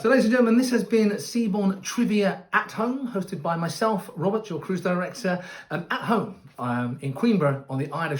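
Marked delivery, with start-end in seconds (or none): 0.0-5.4 big bright showman welcome; announcer voice. Acted. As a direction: boom it out like a cruise host. Cruise host signs off show.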